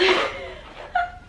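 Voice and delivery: high delighted laugh; burst of laughter. Real, not acted. Laughing at her dog's paws in the lamp. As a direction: laugh out in surprise.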